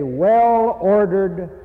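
well-ordered stretched very slowly; drawn out. Real, not acted. Preacher drawing out word.

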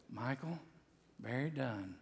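a soft low voice on the phone. Retold or imitated. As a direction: speak the name gently, like a caller from far away. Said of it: "he said it so softly".

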